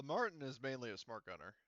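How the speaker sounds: ordinary delivery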